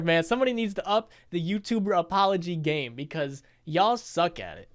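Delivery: ordinary talk, nothing marked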